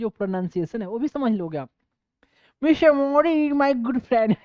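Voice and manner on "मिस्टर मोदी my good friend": higher, louder mock Trump greeting; hammed-up American president voice; Trump impression. Teacher imitates Trump greeting Modi, mock American. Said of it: "यहाँ तक कि Trump की भी pronoun".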